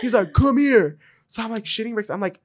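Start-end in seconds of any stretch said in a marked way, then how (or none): 0.3-1.0 mock commanding shout, half strength. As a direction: call someone over as the tough guy.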